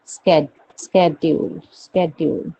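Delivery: "शेड्यूल" as "sked-yool"; their pronunciation. Shown as a demonstration. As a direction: drill the SK start of "शेड्यूल". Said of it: "क्या बोलते हैं वो?".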